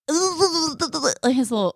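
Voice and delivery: slow, odd cartoon voice try; attempted SpongeBob. Host fumbles a SpongeBob voice for her cohost, slow and odd. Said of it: "I'm trying to think of how he talks".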